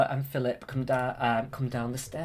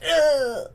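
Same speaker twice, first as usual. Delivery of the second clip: dying grunt; 13 semitones higher, 8 dB louder than usual. Retold or imitated. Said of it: "it just goes".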